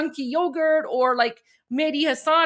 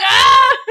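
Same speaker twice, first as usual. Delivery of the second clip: loud, high "Ah!" roar; teenage boy roar; 10 semitones higher, 12 dB louder than usual. Retold or imitated. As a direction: let out a high-pitched boyish roar. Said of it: "like a teenage boy roar".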